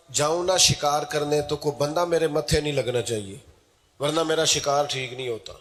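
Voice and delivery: ordinary talk, nothing marked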